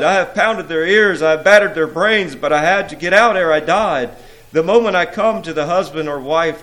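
loud, high, urgent pleading; exasperated protest. A narrator's reading. Jealousy defends failure to the prince, exasperated protest. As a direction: pile up excuses with hammered emphasis.

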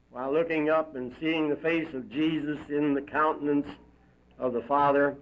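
plain reading voice

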